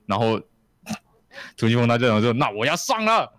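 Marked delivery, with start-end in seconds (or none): 2.3-3.3 high anime hero cry in Japanese; mock anime shout; hero's battle cry. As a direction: yell it like an anime hero powering up.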